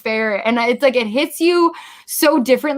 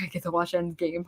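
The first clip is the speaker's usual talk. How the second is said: hushed excitement; 5 semitones lower, 11 dB quieter than usual. Retold or imitated. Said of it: "And I was like".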